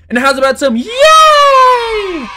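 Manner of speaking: high, slow, loud hammed-up yay; mock cheer. Editor mocking his own level-up with a cheer. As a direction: squeal a fake celebration.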